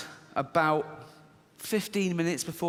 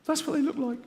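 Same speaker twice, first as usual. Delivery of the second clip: fearful inner voice; 9 semitones higher, faster than usual. Retold or imitated. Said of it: "this one goes".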